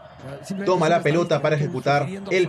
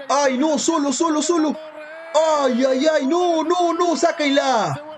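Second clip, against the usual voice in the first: panicked fan; 7 semitones higher than usual. Real, not acted. Fan panics at a rival chance.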